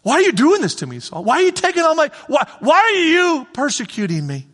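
a wounded divine plea; pleading voice of Jesus. Acted. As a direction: ask why, hurt and insistent.

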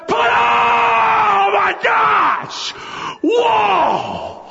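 high slow shout of amazement; excited reveal. Shown as a demonstration. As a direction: burst out at the big reveal. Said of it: "You do a".

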